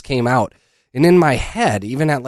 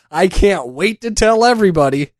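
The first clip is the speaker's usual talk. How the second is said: ordinary talk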